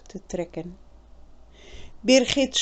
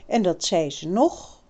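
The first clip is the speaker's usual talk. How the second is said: usual speaking voice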